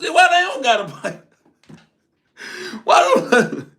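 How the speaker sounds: breaking into laughter mid-question; laughing it off, amused and incredulous. Real, not acted. Recalling being blamed for no gun, laughing.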